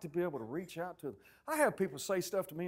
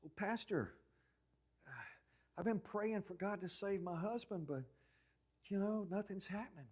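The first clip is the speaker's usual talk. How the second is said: soft imitation of a troubled churchgoer; worried parishioner; 3 semitones higher, 6 dB quieter than usual. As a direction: confide softly to the pastor. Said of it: "they'd always say things like".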